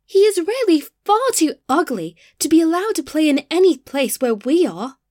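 loud, high-pitched outraged protest; haughty flowers crying out in indignation; indignant cry. A narrator's reading. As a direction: cry out in offended disdain. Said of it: "cried the tulips".

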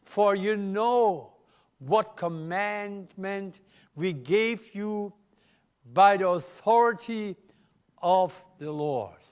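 slow, weighty Bible reading; solemn scripture reading. Acted. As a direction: read the verse slowly with gravity. Reads scripture slowly.

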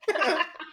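sudden high laugh; embarrassed high laugh; high burst of laughter. Real, not acted. Caught not having read ahead.

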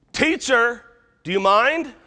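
loud, high demand at the teacher; indignant yell. Acted. Disciples calling to Jesus in the storm, indignant yell. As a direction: shout up at him, pitched high and affronted. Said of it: "We yelled at Jesus".

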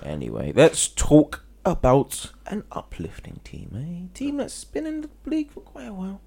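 odd put-on voice. Podcaster slipping into a silly host voice. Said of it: "I don't know why I'm speaking like this".

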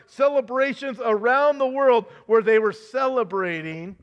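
ordinary delivery

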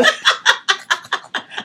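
high, loud burst of laughter; real loud laugh. Real, not acted. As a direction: burst out laughing mid-story. Laughs at own retelling.

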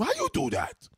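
put-on high, loud Haitian-accented father. Mimicking the Haitian father too, outraged Haitian accent. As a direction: yell it as an outraged Haitian dad. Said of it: "Haitian father too".

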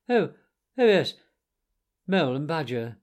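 breezy, offhand remembering; lightly airy. A narrator's reading. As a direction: toss it off lightly, as if an afterthought. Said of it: "said Toad lightly".

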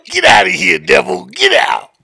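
loud hammed-up prayer warrior; mock preacher. Driver mocking an Instagram prayer live, loud preaching. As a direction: preach it like casting out a devil. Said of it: "I was like".

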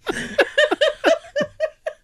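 high burst of laughter. Real, not acted. Friend laughing after quoting Lucas, high laugh.

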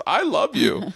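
high squeaky toy voice. Retold or imitated. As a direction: mimic the plush dog's recorded line. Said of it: "a pink dog with panties that says".

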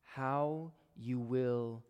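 slow emphasis. Shown as a demonstration. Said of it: "repeated phrase slowed".

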